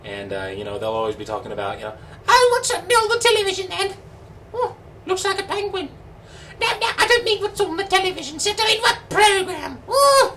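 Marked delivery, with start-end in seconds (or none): 2.3-10.3 very high falsetto British lady; hammed-up shrieky-high comic British housewife; Monty Python British lady. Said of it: "is the Monty Python British lady".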